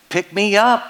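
high squeaky frog call; a tiny frog pleading to be lifted; squeaky frog calling out again. Acted. The voice calls again.

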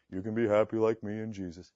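mock monotone with no zeal; deadpan imitation of a half-hearted pitch; joyless witness. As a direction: recite it dully, imitating a joyless believer.

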